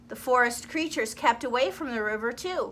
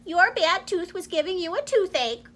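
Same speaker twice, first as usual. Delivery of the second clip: higher little mouse voice; 7 semitones higher than usual. Acted. Mouse explaining to the crocodile, higher voice. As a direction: explain it kindly as a small mouse. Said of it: "said the mouse".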